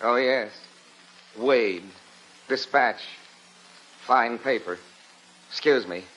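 low, terse, offhand fragments; feigned indifference. Acted. Husband pretending to be too busy for the reporter, offhand. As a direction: brush the visitor off as if too busy.

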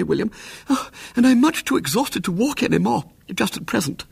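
put-on high, fussy old lady protesting; flustered aunt. A narrator's reading. Exhausted aunt forbidding William to leave, high and fussy. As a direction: flutter and fuss, refusing to move.

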